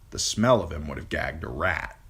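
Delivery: ordinary delivery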